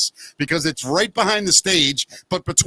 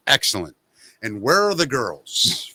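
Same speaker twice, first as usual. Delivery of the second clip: [name]; 4 semitones lower than usual. Retold or imitated. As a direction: imitate a smug star savoring every word. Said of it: "He goes".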